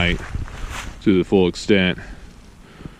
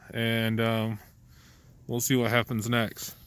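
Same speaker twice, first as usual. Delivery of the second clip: quiet; 9 dB quieter than usual. Real, not acted. Night in cold hammock.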